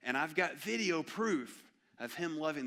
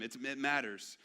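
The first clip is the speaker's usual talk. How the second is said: ordinary delivery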